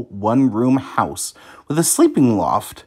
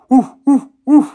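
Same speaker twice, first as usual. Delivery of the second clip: loud high woof woof woof; high yappy woofs; barking dog; 13 semitones higher, 9 dB louder than usual. A narrator's reading. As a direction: bark it out like a dog. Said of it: "barked the dog".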